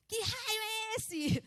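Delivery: high, drawn-out imitation of a panicked wail; mock a passenger freaking out, stretching every word; mimicked panic. Daniel panics at a light in the window, mimicked wail. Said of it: "O Daniel entrou numa crise de pânico".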